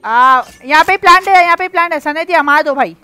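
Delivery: frantic loud callouts; yelling urgently at teammates; panicking gamer. Real, not acted. Clutch round, spike being planted.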